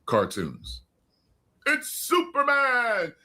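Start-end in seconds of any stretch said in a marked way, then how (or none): 1.7-3.1 high, slow, grand announcer cry; cartoon announcer. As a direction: boom it out like the old radio-serial narrator. Hyping the old cartoon intro.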